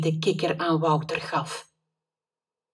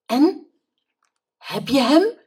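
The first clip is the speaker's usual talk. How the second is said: panting; 9 semitones higher, 8 dB louder than usual. A narrator's reading. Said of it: "hijgde hij".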